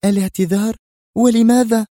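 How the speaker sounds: very high, loud surprised questions; astonished. A narrator's reading. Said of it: "سألتها ريهام في دهشة".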